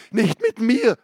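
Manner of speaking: high, loud refusal; defiant shout. Acted. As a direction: throw it back at him. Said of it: "rief Renker".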